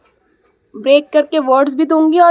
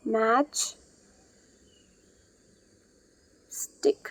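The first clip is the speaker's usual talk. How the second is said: "Matchstick" very softly, with a long pause after मैच; "matchstick" in two hushed pieces, far apart; drawn-apart word parts; 2 semitones lower, 13 dB quieter, much slower than usual. Shown as a demonstration. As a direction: split "matchstick" slowly and quietly into मैच and stick. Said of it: "अब हम इसको join करेंगे".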